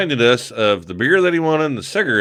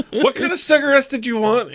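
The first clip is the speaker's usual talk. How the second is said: calling out; 14 semitones higher than usual. Retold or imitated. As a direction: keep calling over to Chad. Calling across the store.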